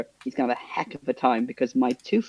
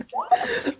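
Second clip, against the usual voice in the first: high squeaked what through a laugh; laughing stunned what; shocked what. Retold or imitated. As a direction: disbelief breaking into laughter. Shocked reaction retold with laughter.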